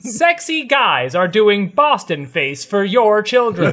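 playful doom-laden public warning; mock alarmist warning. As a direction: deliver a mock scare ad, slowly. Joke warning after co-host's complaint.

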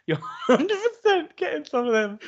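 high laughing, half shouted tease. Real, not acted. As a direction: crack up mid-sentence teasing. Teasing laugh while talking.

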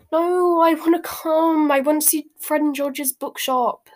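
put-on high, loud boyish protest; hasty plea. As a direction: rush to backpedal. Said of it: "said Ron hastily".

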